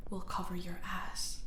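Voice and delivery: soft threatening whisper; menacing whisper. Acted. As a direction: hiss it close to her ear. Villain menacing a captive.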